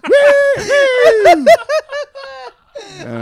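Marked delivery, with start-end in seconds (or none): 0.1-1.5 high, loud hunter whoops; whooping cheer. Retold or imitated. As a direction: whoop like a rowdy hunter celebrating.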